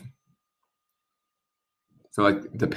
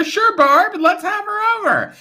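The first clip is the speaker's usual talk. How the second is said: much higher, bubbly friend voice; high girly voice. Retold or imitated. Card women inviting a friend over, high girly voice. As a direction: squeal it like an excited party girl. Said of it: "They're like".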